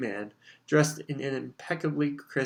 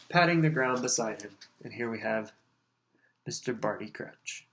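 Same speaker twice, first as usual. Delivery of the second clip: plain reading voice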